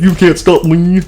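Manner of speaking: taunting. Acted. Fredbear taunting Baby.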